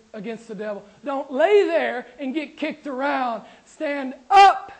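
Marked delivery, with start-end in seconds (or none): 3.7-4.7 loud stand up. Shown as a demonstration. Preacher rallying the congregation, loud shout. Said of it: "it's not a passive thing".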